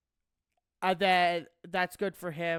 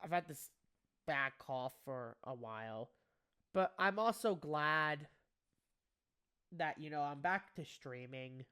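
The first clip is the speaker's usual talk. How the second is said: ordinary talk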